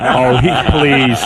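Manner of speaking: a high laugh, then a slow laughing "oh please". Real, not acted. Laughing off the co-host's jab about Steve Langford. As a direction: laugh it off, drawn out.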